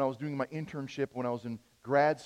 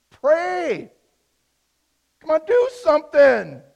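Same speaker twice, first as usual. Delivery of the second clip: much higher and louder, demanding; retold urgent shout; 12 semitones higher, 14 dB louder, slower than usual. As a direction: yell at the slow pastor to get moving. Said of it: "I'm like".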